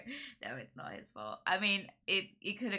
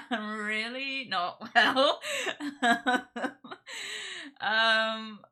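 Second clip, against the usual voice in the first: loud, slow words through laughter; laughing helplessly; 5 semitones higher, 8 dB louder, much slower than usual. Real, not acted. Sick streamer to viewers, loud laughing admission.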